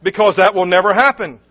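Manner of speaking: loud, high, with a scornful laugh; mocking snap. Head nurse scoffs at Edith's prayer, scornful laughing snap. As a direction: laugh it off harshly. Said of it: "snapped Phyllis".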